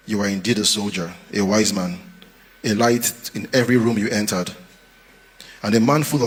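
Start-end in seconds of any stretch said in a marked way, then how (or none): none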